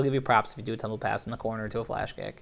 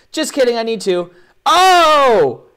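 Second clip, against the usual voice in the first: high, loud, excited mock outburst; hammed-up sudden reversal; 10 semitones higher, 11 dB louder, slower than usual. Host voicing a swordsman taking back his claim, excited mock outburst. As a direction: burst out with it, ending on a loud cry.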